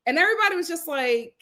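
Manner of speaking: ordinary talk, nothing marked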